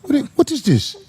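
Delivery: imitating a Nigerian auntie's what is this; playful Nigerian accent. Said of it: "Like the Nigerian do".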